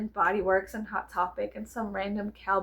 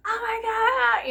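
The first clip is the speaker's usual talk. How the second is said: high, slow gasped oh my God; a mock bystander gasping at a fight; shocked gasp; 12 semitones higher, 3 dB louder, slower than usual. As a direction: act stunned by the fight. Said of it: "Everybody would be like".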